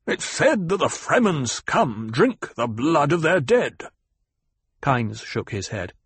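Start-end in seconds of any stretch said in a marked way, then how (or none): 0.1-3.8 slow, pitched-up, heavily punched indignation; angry banker. Acted. Banker accuses the Fremen at dinner, angry and emphatic. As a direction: spit out each stressed word in anger. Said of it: "spoke in an angry voice".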